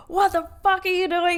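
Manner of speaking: high, loud angry bus driver; retold yell. Bus driver confronts a passenger in the aisle, yelling. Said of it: "yelled at me".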